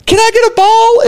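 put-on high-pitched little-boy request; eight-year-old kid pleading. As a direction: imitate an eager child asking a favor. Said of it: "And he's like".

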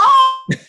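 high amused laugh; burst of laughter. Real, not acted. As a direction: laugh at the imitation. Laugh after imitation.

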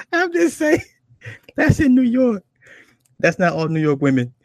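high, loud words through laughter; cracking up. Real, not acted. Comic excusing his New York impression, cracking up. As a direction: protest while laughing hard.

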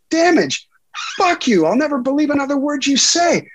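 very high, outraged re-enacted yell; replaying a screaming phone fight at high pitch; retold screaming. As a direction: explode in a high-pitched fury. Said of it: "I'm screaming".

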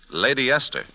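ordinary talk, nothing marked

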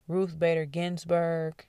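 normal talking voice

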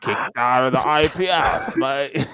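mock Australian accent ending on mate; jokey Australian accent, stretching mate. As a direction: ham up an Australian bloke. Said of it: "let me do my Australian accent".

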